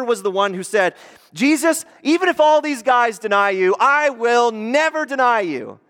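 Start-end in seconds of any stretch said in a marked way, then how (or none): 1.3-5.8 loud, high, emphatic declaration; boastful vow. Acted. Peter vowing loyalty to Jesus, loud overconfidence. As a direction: swear it with overconfident force, slowing at the end.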